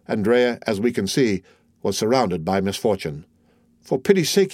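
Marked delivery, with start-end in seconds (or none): 3.8-4.5 high-pitched, urgent pleading; bewildered plea. A narrator's reading. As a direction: beg in restrained alarm. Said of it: "he cried, pale and bewildered".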